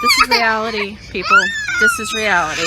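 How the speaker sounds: loud, cracking exasperated cry; frazzled shout. Real, not acted. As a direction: yell it out, at the end of her rope. Exhausted mom yelling on plane.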